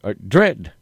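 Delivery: put-on muddled British accent; bad British accent. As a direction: amend it in dubious British. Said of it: "That was British".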